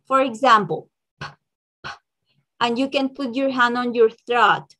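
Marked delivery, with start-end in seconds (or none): 1.2-1.9 bare "p" popped on breath alone; isolated "p" with no vowel; voiceless p sound. Shown as a demonstration. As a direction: puff out "p" without throat vibration. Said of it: "Es without vibration".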